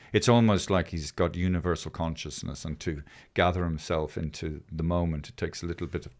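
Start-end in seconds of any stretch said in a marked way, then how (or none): none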